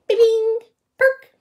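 high, loud little pops; bright-eyed, high-pitched ping; perky sound effect. A narrator's reading. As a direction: sound out ears springing up. Imitates ears perking up.

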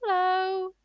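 loud, drawn-out British hello; exaggerated British greeting, held long. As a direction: call out a hammed-up British hello. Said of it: "very British".

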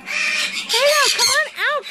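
high call to the pigs. Real, not acted. Owner calls pigs to come out of the door, high call.

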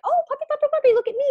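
high puppy-calling voice. Retold or imitated. Owner calling her puppy away from another dog, high sing-song coo. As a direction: coo the puppy's name to win its attention. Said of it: "And then I'm like".

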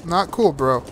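usual speaking voice

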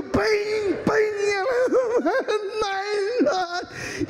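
high, half-laughing, half-crying shouts; frantic high cry. Retold or imitated. Comedian shrieking through the climax of the fight story. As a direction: scream it out while cracking up.

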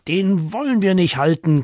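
mild mock screech. Two pets answer their owner back, mild mock screech. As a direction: protest in a half-hearted screech. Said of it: "kreischen die zwei".

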